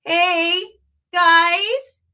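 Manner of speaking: put-on complaining kid's call; complaining child. Shown as a demonstration. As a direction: nag like a complaining kid. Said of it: "just say that for me".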